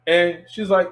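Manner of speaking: ordinary delivery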